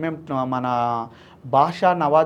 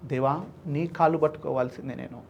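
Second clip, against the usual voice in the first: softer, lower, broken prayer; tearful prayer; 4 semitones lower, 9 dB quieter than usual. Retold or imitated. Man praying to God while bowing at feet, softly tearful. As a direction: pray to God while weeping quietly. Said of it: "ఏడుస్తున్నా".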